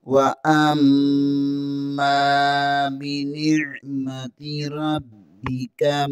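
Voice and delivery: long, drawn-out Quranic chant; slow recitation. Shown as a demonstration. Teacher models the verse for students, slow chant. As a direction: stretch every vowel as in tilawat.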